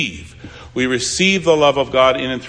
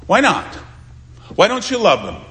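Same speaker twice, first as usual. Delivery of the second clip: acted challenge; 7 semitones higher than usual. Preacher voicing a challenge to a reluctant helper, pressing. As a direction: challenge them, pitched up sharply.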